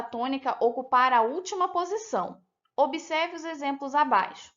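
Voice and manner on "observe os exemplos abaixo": ordinary talk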